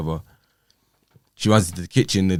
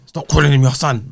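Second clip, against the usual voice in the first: higher, louder mock retort; ex's sharp reply, raised; imitating her snap; 7 semitones higher, 7 dB louder than usual. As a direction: throw her line back with attitude. Retold ex's snapping line.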